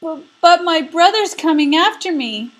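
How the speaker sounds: little goat pleading in a high bleat. Acted. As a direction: stammer like a scared little kid goat. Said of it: "the little billy goat bleated".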